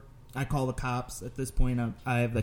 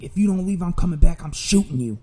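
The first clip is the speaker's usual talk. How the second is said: higher, louder mock threat; acted threat; 5 semitones higher, 6 dB louder than usual. Aggressor threatens cashier if he won't leave, angry. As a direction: imitate an angry man threatening.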